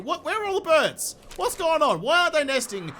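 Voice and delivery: high-pitched disbelief; baffled outburst. Real, not acted. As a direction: sound truly baffled. Baffled by missing birds.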